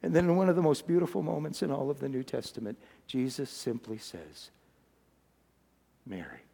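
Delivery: low, very soft, dropping almost to nothing; hushed reverence. Acted. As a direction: let it sink to a tender, hushed name. Most beautiful moment, tender naming.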